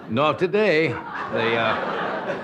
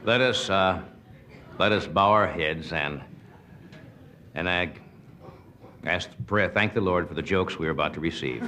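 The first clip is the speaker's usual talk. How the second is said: lower, hushed mock-solemn grace; mock prayer; 5 semitones lower, 3 dB quieter than usual. Host leading the audience in grace for the jokes, mock-reverent.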